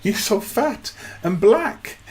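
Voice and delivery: very high, loud, laughing mock voice of the girl; imitating the date. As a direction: squeal it like the delighted date. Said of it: "she, she goes".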